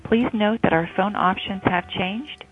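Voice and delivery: ordinary talk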